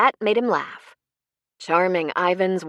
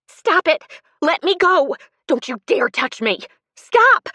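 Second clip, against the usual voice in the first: rising into high shrieks for help; terrified shrieking, much louder at the end; 11 semitones higher, 7 dB louder than usual. A narrator's reading. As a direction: scream to be let go, higher and louder. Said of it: "she shrieked".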